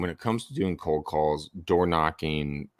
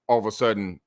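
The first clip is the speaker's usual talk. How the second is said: usual speaking voice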